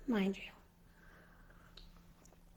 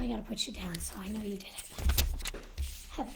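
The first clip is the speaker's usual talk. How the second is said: whispered aside; 3 semitones lower, 5 dB quieter, faster than usual. Real, not acted. Aside while noting a viewer.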